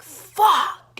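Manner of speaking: loud, high burst of swearing; retold cursing yell. Vlogger reliving the moment on the floor, cursing yell. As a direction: yell it as the mishap hits.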